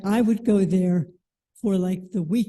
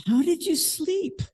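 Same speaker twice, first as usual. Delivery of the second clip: kindly monk asking; 9 semitones higher than usual. Retold or imitated. Monks greeting guests after breakfast.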